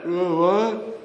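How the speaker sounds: ordinary delivery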